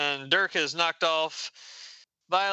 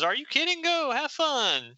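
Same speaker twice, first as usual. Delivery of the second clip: higher, eager encouragement; enthusiastic approval; 10 semitones higher than usual. Acted. Papa sending daughter off on a date, eagerly high. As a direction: play a delighted father waving them on.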